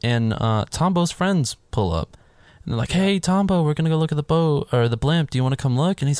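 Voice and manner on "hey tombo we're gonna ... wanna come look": higher-pitched mock friendly invitation; friendly calling out. Friends invite Tombo to see the blimp, lightly acted friendly call.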